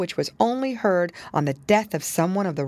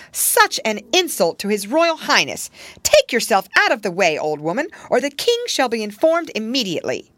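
loud, raised, haughty threat; indignant scolding; 5 semitones higher, 4 dB louder than usual. A narrator's reading. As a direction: order her away in outrage. Nurse's indignant scolding of woman.

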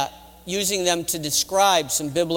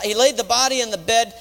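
ordinary talk

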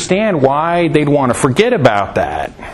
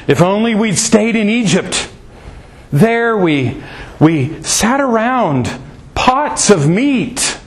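higher, slow wistful whine for the meat pots; grumbling Israelites; 7 semitones higher, slower than usual. Acted. Hungry Israelites whine to Moses, higher and slow. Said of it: "they tell Moses".

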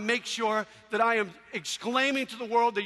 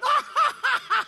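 high, loud hammed-up cackle; mocking dog laughter; 7 dB louder than usual. Neighborhood dogs laughing at his poodle, mocking. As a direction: imitate jeering dogs laughing at a sissy. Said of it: "All the other dogs are going".

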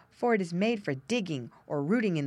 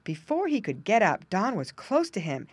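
ordinary delivery

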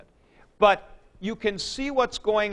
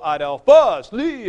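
not-allowed double acute; 4 semitones higher, 8 dB louder than usual. Shown as a demonstration. Teacher shows students a forbidden Greek accent pattern, overemphatic. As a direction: voice pushed up on "Adelphos", carried into "Li". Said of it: "they did not go".

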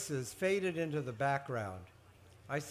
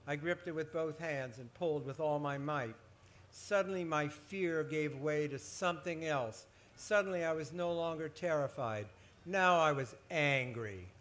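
plain reading voice

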